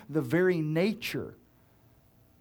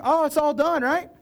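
mock assumption; 10 semitones higher, 4 dB louder than usual. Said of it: "you would think".

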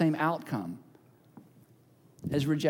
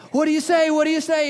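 insistent mom; 7 semitones higher, 5 dB louder, faster than usual. Acted. Mom prompting child again.

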